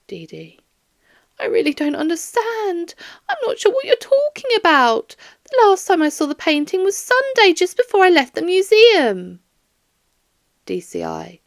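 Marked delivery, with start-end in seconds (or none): 1.4-9.4 put-on higher, fluttery old woman's voice, confused; a sweet elderly woman who doesn't understand; bewildered old lady. Elderly Doris answers confused.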